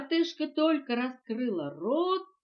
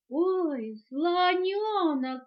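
high, drawn-out worried oh; dawning alarm, stretch the words; frightened; 7 semitones higher, 5 dB louder, much slower than usual. A narrator's reading. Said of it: "испугалась вдруг притихшая мартышка". (испуганно)

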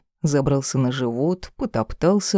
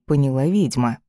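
ordinary delivery, nothing marked

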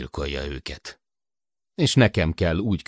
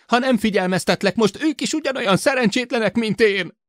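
high, bitter, aggrieved tirade; outraged high-pitched shouting; shouted rant; 4 dB louder than usual. A narrator's reading. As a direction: rage on with voice pushed high. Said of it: "kiabált tovább Sid".

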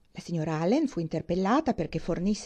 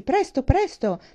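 loud, raised call to hurry up; hurrying shout; 7 semitones higher, 6 dB louder, slower than usual. A narrator's reading. Thorpe calling to Miss Morland from the stairs, urgent shout. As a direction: shout to rush someone along. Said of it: "gridandole di sbrigarsi".

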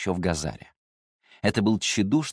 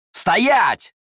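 sharp, loud, high bark; barked order; 13 semitones higher, 9 dB louder than usual. A narrator's reading. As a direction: bark the command at him. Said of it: "рыкнул он".

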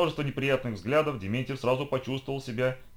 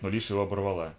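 usual speaking voice

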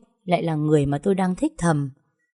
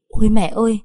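louder, higher gasp of shock; startled cry; 4 semitones higher, 6 dB louder than usual. A narrator's reading. Narrator sees the ghost at the window, startled. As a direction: blurt it out in fright.

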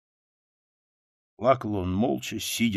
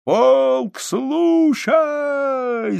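very high, loud, drawn-out command; full-voiced military bellow; parade-ground shout; 8 dB louder, much slower than usual. A narrator's reading. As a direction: call the regiment to attention, holding the vowels. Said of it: "закричал солдат".

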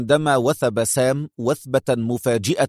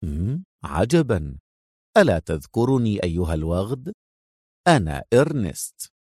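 smug amused reply; sarcastic; 5 semitones lower than usual. A narrator's reading. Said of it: "ابتسم في سخرية مجيبا".

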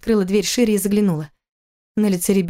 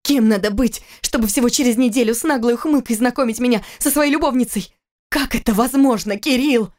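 raised, bitter accusing woman; Kira's angry reproach; 5 semitones higher than usual. Acted. Wife confronting her cheating husband, bitter and raised. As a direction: hurl the betrayal back at him.

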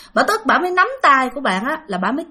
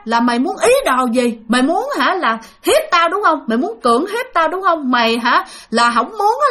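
higher-pitched, indignant mock rant; retold angry accusation; 6 semitones higher than usual. Sick cousin accuses the visitor of bad intentions, acted indignant rant. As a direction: fire off accusing questions as the ranting cousin. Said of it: "là cứ chửi bạn".

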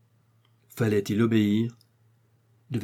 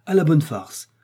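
amused scorn; 5 semitones higher, 5 dB louder than usual. Acted. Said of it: "elles riaient à se tordre".

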